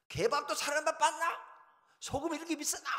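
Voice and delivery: loud, high Gyeongsang-do complaint; irate customer hollering, hammed-up; acted angry yelling. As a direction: shout your disgust on the way out. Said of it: "이러면서 돈을 다 주고 나와".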